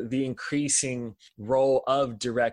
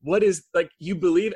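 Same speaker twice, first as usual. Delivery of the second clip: ordinary talk, nothing marked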